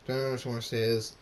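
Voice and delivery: ordinary delivery, nothing marked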